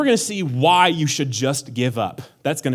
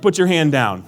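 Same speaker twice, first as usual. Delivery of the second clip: ordinary talk, nothing marked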